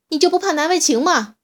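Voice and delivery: loud, high angry yell; shouting. A narrator's reading. Little dinosaur shouting at the huge T-rex to shame him. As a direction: scold the giant at full voice. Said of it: "椰子冲着巨大的乌提莫大吼".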